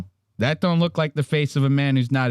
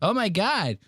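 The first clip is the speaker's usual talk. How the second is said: 1 mildly high whining Mark; 7 semitones higher than usual. Retold or imitated. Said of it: "He goes".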